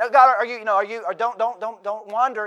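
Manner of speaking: loud, high, stumbling interruption; mock pleading for attention. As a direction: pretend to call back a wandering listener. Mimics nagging God for attention.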